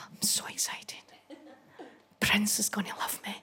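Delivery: whispered, breathless thrill; excited whisper. Retold or imitated. Fan in the corner whispering to herself about [name]. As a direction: whisper it to yourself before meeting him.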